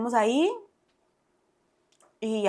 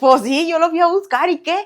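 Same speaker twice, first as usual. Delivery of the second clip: louder, higher, in-your-face admission; defiant retort; 7 semitones higher, 8 dB louder than usual. Retold or imitated. Retorting to her brother-in-law, a higher, louder defiant admission. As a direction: throw it back at him, higher and louder.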